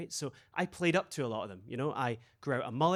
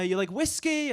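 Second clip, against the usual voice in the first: imitating Spanish guy; 9 semitones higher than usual. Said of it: "He said".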